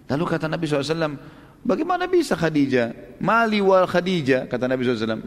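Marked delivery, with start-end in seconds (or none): 1.7-4.5 higher, slow disbelieving question; incredulous reply. Retold or imitated. The Prophet answers Nafisa's proposal of Khadijah, incredulous. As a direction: voice lifted in mild astonishment.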